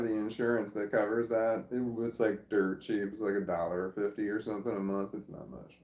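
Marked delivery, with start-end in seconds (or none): 5.1-5.8 whispered aside. Real, not acted. Cheap insurance aside.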